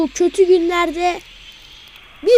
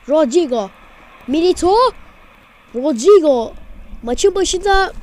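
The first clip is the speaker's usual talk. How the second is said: ordinary talk, nothing marked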